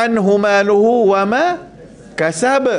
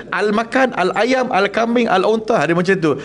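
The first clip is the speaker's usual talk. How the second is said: normal talking voice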